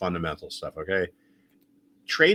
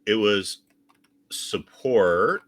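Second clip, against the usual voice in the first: usual speaking voice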